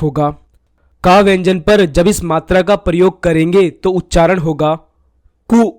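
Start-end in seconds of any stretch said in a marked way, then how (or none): 5.5-5.7 कु drawn out as a long koo. Shown as a demonstration. Teacher demonstrates the long koo for pupils. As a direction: stretch कु into koo. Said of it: "छोटे ऊ से ज्यादा समय लगेगा".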